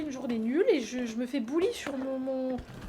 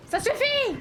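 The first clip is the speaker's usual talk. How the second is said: high mock scold; 11 semitones higher, 4 dB louder, slower than usual. Real, not acted. Scolding chat teasing.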